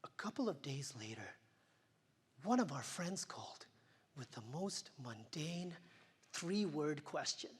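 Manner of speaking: hushed whisper. Acted. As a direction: drop to a whisper. Hushed turn after grief.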